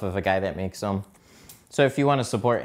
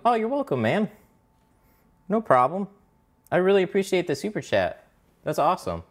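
excited thanks; 8 semitones higher than usual. Real, not acted. Thanking viewer for super chat.

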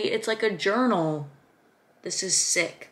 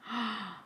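soft high intake of breath; quiet thrilled gasp; amazed gasp; 4 semitones higher, 8 dB quieter than usual. Real, not acted. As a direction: gasp in delighted surprise. Amazed by planner page.

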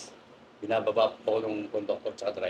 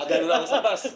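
laughing; 8 semitones higher, 5 dB louder, faster than usual. Real, not acted. Laughing while recounting.

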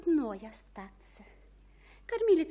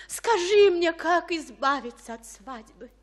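pleading cry; 4 semitones higher, 9 dB louder than usual. Acted. Juliet pleads for escape.

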